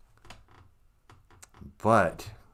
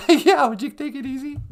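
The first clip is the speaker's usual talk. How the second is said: high teasing voice; 6 dB louder than usual. Acted. Offscreen voice teasing.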